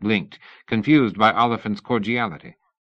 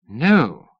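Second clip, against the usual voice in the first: feigned shock; 4 semitones higher, 6 dB louder than usual. A narrator's reading. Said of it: "Oliphant feigned shock".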